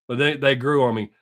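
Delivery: ordinary talk, nothing marked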